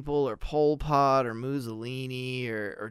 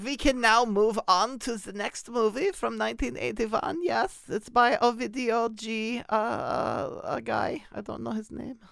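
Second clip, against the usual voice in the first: high mock German accent; coy German man; 9 semitones higher than usual. Host moving on to the next movie, coy mock German. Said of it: "I like your coy German man".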